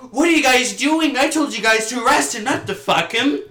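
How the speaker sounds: high, loud scolding voice; outraged lady. Retold or imitated. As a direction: scold the cops, indignant. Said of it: "she's like".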